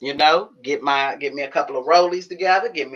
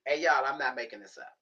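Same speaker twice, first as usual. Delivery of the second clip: ordinary talk, nothing marked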